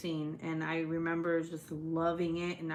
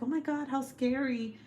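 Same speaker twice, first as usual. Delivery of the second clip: higher-pitched mock fright; frightened little girl; 7 semitones higher than usual. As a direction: imitate a scared small child. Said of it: "when I was little, I was like".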